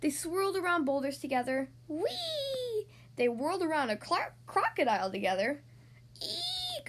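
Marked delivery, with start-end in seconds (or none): 1.9-2.9 high, drawn-out squeal of fun; gleeful whee. A narrator's reading. Friends swirl around the boulders. 6.2-6.9 very high, small eek; mock frightened squeak. A narrator's reading. They meet a crocodile.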